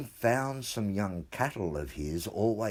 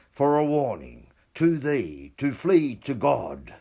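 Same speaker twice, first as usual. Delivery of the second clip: ominous proclamation; 5 dB louder than usual. Acted. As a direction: declaim it like a curse written in blood. Ominous letter written in blood.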